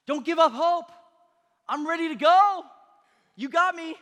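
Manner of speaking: high, loud shout, eager to be sent; fired-up shout. Acted. David volunteering to King Saul to fight, fired-up shout.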